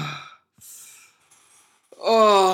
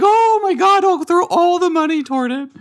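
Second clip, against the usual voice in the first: high squealing gush over cute animals; gushing woman voice; 13 semitones higher than usual. Retold or imitated. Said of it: "the women are like".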